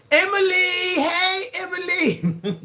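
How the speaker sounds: high calls of "Emily!"; calling out. Real, not acted. High call to a viewer named Emily. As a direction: call her name up high, the last one stretched.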